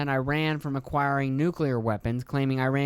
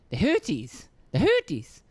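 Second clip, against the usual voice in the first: high, hooty squeal on Houthis; playful high mockery of the name; mocking name-call; 4 semitones higher, 3 dB louder than usual. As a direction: hoot the name in a silly swooping pitch. Mocks the silly-sounding name.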